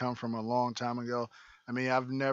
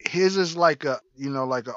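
ordinary delivery

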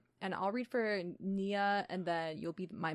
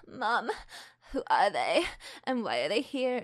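affected indie singer delivery; lightly mocked indie singer style. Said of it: "talk like indie singer voice".

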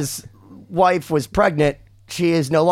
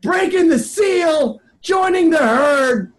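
higher, drawn-out hype call; hype announcer intro; 7 semitones higher, slower than usual. Acted. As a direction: sell the guest like a ring announcer. Hyped announcer introducing guest.

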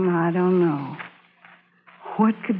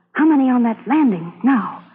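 ordinary talk

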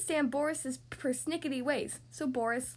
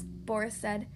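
plain reading voice

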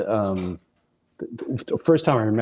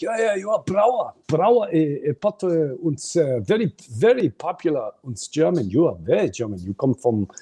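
second German clerk; 5 semitones higher than usual. Retold or imitated. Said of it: "he's like".

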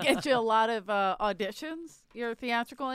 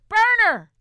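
higher, louder excited blurt; 11 semitones higher, 10 dB louder than usual. Real, not acted. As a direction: blurt the name out eagerly. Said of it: "blurting a name".

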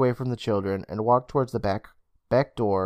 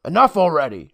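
higher, louder annoyed comeback; irritated retort; shooting back; 5 semitones higher, 5 dB louder than usual. A narrator's reading. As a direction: snap back at a teasing brother. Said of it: "Emma shot back".

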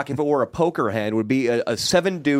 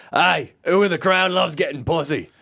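straight comic voice; 2 semitones higher than usual. Retold or imitated. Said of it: "should be super straight".

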